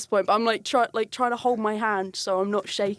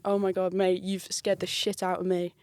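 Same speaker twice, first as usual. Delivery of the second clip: usual speaking voice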